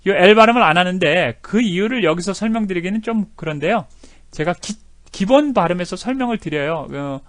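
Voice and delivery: ordinary delivery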